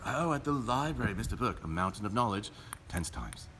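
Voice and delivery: hushed tension. Pretend. Commentator hushing the viewers at a tense moment. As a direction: hush it like the crowd holding breath.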